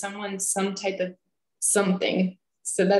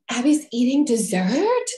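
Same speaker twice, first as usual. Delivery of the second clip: loud, higher scandalized question; mock shock; 5 semitones higher, 5 dB louder than usual. Family spotting her with dessert, mock shock. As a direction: imitate a relative aghast at the sight.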